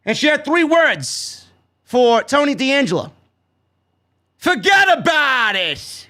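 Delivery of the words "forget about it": slow, shouted bad New York accent on "Forget about it"; mock New York accent. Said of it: "most hideous New York attempts at an accent".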